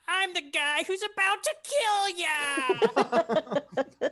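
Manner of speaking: high, slow and loud whine with a laugh; whiny wizard voice. Acted. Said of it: "Whiny wizard voice".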